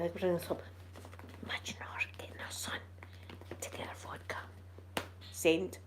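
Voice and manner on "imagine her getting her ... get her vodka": whispered aside; conspiratorial whisper. Real, not acted. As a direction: breathe it out as a secret joke. Aside mocking a viewer's comment.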